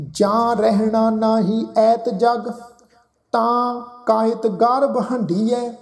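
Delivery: slow, measured reciting of a sacred verse; scripture recitation. Shown as a demonstration. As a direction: draw out each word of the verse. Recites scripture line as example.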